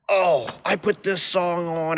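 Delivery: high, slow, drawn-out mock voice; mimicking the pushy bar guy. Narrator mocks the clingy guy at the bar, slow and high. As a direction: lay it on thick as the annoying guy. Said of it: "he's like".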